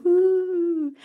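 high, wavering ghostly hoot; spooky bat whoo. Acted. Storyteller showing the bat floating through, eerie high hoot.

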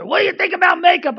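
loud, high, rapid confrontation; mean religious lady. Retold or imitated. Meanest lady challenging him about makeup, loud quick demand. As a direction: imitate a mean church lady demanding an answer. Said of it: "this one lady was the meanest lady".

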